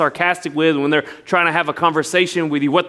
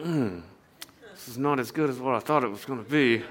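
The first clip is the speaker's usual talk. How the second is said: much softer, lower disappointed grumble; muttered disappointment; 4 semitones lower, 10 dB quieter than usual. Retold or imitated. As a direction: say it under your breath after a bad sip. Disappointed aside after sip.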